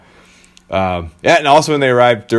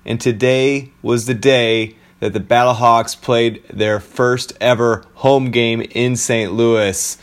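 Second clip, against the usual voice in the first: podcast host intro; 4 semitones higher, slower than usual. Acted. Opens the podcast.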